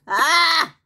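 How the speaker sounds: high, cut-off yell; short scream. Acted. Boss losing it as customers leave, screaming. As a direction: let out a sharp scream.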